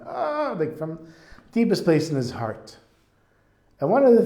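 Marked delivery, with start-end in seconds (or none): 0.1-0.5 short high-pitched mock sob; hammed-up cry; mock crying wail. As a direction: let out an acted high wail. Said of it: "he's really crying".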